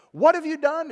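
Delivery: God shouting at Cain, a sharp loud demand; shouted accusation. Shown as a demonstration. God confronting Cain about his brother, a loud shouted question. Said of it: "You wonder how he said it".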